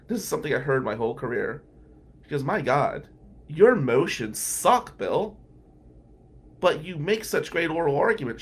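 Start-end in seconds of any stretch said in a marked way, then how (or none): 2.4-8.4 mock exasperated colleague, higher and louder; imitating her teasing. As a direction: tease him with a raised, drawn-out scold. Retelling colleague's blunt teasing.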